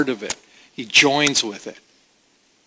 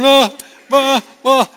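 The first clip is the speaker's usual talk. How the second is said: higher, stammering imitation of a sobbing child; mock blubbering toddler; 11 semitones higher, 4 dB louder than usual. Toddler trying to tell his mom what's wrong, mock blubbering. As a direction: imitate a toddler choking on sobs. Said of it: "he's crying and blubbering".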